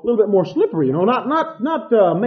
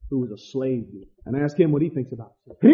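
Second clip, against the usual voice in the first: ordinary talk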